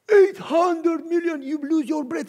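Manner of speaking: slow, high, loud breathless awe; stunned awe. Acted. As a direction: let the number hit you. Stunned at the sum.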